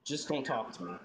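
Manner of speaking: low, soft brush-off; sulky. Acted. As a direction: mumble it, wanting to be left alone. Sulking son rebuffs questions.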